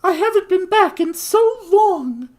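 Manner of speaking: high falsetto woman's voice; female voice. Acted. Noblewoman reassuring the shopkeeper, high falsetto. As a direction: lift it into a lady's falsetto. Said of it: "the most consistent quote-unquote female voice".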